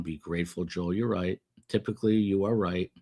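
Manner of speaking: ordinary talk, nothing marked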